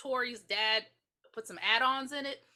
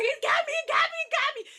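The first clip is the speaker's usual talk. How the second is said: much higher, louder frantic cry; mock panic; 7 dB louder than usual. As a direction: shriek it while stabbing wildly.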